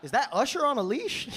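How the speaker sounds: high, loud disbelief through a laugh; amazed gawking. Retold or imitated. Tourist gawking at a strange sight, amazed and laughing. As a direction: gawk at the sight, laughing in shock. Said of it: "he'd just be looking around like".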